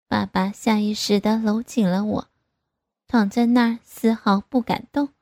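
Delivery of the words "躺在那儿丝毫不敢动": ordinary delivery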